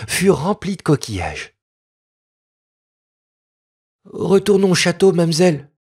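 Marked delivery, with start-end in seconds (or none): 4.1-5.7 put-on a touch of fear in a high child's voice; the boy urging her home, mildly scared; frightened boy. A narrator's reading. Peasant boy begging Noémie to go back, mild fear. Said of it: "dit le petit paysan effrayé".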